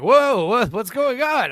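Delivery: surprised. Retold or imitated. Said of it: "everyone was like".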